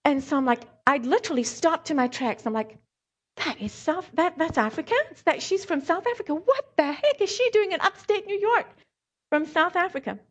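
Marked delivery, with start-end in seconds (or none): 3.4-10.2 higher, amazed inner voice, peaking on upstate New York; puzzled delight at hearing home; a touch of astonished disbelief; excited realization. Retold or imitated. Inner thought on recognising Afrikaans.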